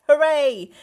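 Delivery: loud happy cheer. Real, not acted. Cheering the strength armband, loud and happy. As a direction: celebrate the armband loudly.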